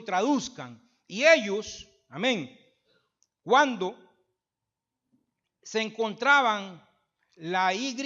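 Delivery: fervent, booming pulpit delivery; preaching at full force. Acted. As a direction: build the sermon to a ringing climax.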